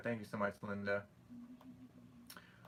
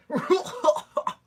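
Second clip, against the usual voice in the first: high, loud burst of real laughter; real laughter bursting out, much higher and louder; 10 dB louder than usual. Real, not acted. Laughing at Hans joke.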